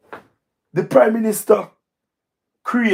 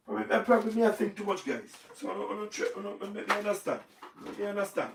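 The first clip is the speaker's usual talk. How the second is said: softer, mocking broken patois, playing dumb; a hushed parody of a man who claims he knows nothing; mock clueless talk; 9 dB quieter, faster than usual. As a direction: mutter it like someone pretending not to understand. Mocking someone who feigns ignorance.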